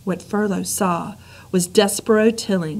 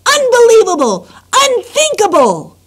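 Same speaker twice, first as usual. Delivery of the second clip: shouting; 10 semitones higher, 8 dB louder than usual. Acted. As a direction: bellow the doom at her. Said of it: "he shouted".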